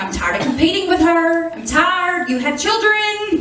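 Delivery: building frustration, pitch climbing; exasperated complaint. Retold or imitated. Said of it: "I'm like".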